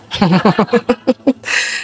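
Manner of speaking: low rippling chuckle; real chuckle. Real, not acted. Preacher teases unmarried people over thirty, real chuckle. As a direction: chuckle at your own teasing.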